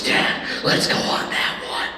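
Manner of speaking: eerie savage daughter. Retold or imitated. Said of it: "she goes".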